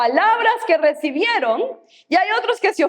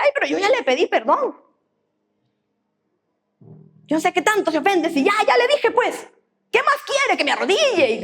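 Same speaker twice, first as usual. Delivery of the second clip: mock indignant complainer; 3 semitones higher, faster than usual. Mocks an unforgiving churchgoer.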